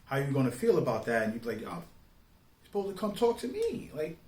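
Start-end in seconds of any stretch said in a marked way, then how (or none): none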